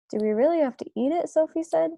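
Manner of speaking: quick, high, loud dismay; alarmed. Acted. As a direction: blurt it out in alarm. Sophie dismayed at the food.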